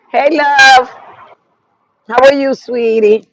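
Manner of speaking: bright, loud and high hello; light up and call out to a friend; high, loud welcome to a live viewer; excited greeting. Real, not acted.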